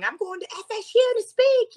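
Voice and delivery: high, bouncing retold cheer; excited squeal. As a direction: squeal it like you just got the news. Jumping up and down with excitement.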